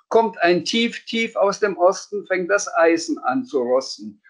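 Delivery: high, sing-song rhyming forecast; a cheeky kid's mock forecast in rhyme; mock weather report. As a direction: chant it like a playful weather announcer. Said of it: "den Wetterbericht gehört".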